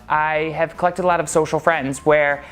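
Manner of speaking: plain reading voice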